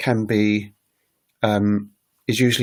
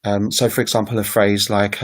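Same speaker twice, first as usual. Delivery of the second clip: ordinary talk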